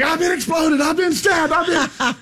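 high-pitched frantic wailing; mock hysterical patient; hammed-up hysterics. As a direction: pile up wild claims in a high panic. Acted hysterical patient exaggerating injury.

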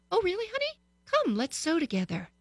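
high, sugary voice for the daughter; a mom cooing at her child; sweet mommy voice. Retold or imitated. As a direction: sweeten it up for honey. Mom answering her calmed daughter.

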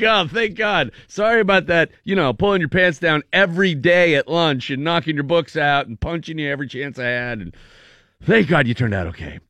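high, breezy fake-sorry bully; mock apology. Bully apologizing casually to his victim, high and breezy. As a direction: toss off the apology like it's nothing.

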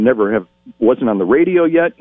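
ordinary talk

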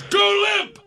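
very high, loud imitated yell; trainer's yell. Trainer yelling instructions to man under bear, full yell. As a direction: belt it out like an alarmed trainer. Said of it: "The trainer just stands up and yells".

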